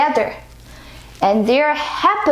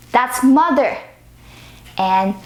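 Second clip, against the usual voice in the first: ordinary delivery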